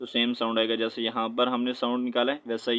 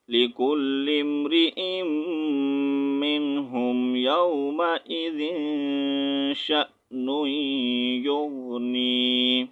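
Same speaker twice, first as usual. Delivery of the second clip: Quran recitation. Shown as a demonstration. Teacher recites the verse.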